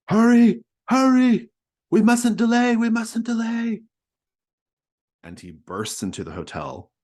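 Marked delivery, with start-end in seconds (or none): 0.1-3.8 high, frantic full-force scream. Acted. Priest running to the hotel, screaming for haste. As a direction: scream it out urgently at the top of the voice. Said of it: "He's screaming".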